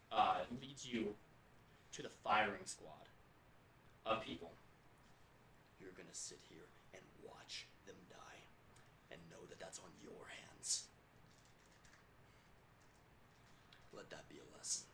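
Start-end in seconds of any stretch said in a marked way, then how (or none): 5.8-14.9 whispered cruel taunt; menacing whisper. Acted. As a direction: hiss it close to his ear. Winters forcing them to watch.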